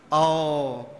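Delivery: normal talking voice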